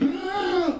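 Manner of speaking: short high gasp for air; mimed drowning gasp. Retold or imitated. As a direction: imitate surfacing and gulping air. Imitates boy surfacing again. (imitação aguda de quem busca ar)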